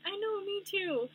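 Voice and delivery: high and drawn out reply; high, slow. Retold or imitated. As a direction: stretch it out up high. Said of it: "I'm like".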